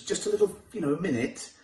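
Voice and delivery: usual speaking voice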